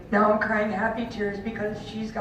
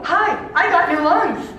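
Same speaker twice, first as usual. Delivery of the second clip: higher and louder, chirpy catchphrase; cheerful friend greeting; 11 semitones higher, 9 dB louder than usual. Retold or imitated. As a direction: announce it brightly to everyone you pass. Friend greeting people outside hospital.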